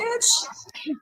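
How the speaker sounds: hissed whisper on the last word; a whispered little dig; whispered taunt. Real, not acted. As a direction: breathe it out as a sly jab. Teasing aside to a rival.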